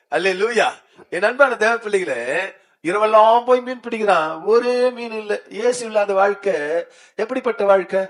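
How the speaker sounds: high, loud, driving sermon voice; fiery preaching. Acted. Pastor urges the congregation to declare Jesus within them, loud and high. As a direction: shout it out to stir the church.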